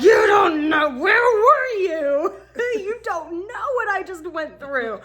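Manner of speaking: high, drawn-out mock wail; hysterical outburst. Wife confronts waiting ex-husband, high overwrought yelling. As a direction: rail at him in comic anguish.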